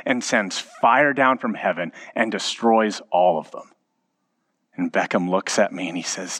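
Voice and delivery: normal talking voice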